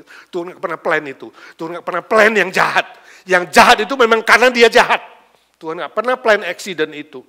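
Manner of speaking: building to a loud, emphatic shout; full-force preaching. Real, not acted. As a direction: hammer the point home to the congregation.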